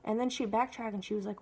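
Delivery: normal talking voice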